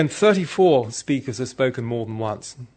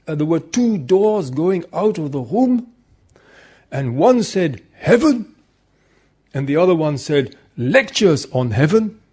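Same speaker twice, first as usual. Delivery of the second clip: higher, louder retold Austrian accent; 5 semitones higher, 7 dB louder than usual. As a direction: put on a hearty Austrian accent for the joke. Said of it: "in his Austrian accent".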